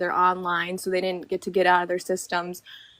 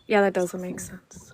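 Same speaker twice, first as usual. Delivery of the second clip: whispered aside; slower than usual. Real, not acted. Aside comment in chat.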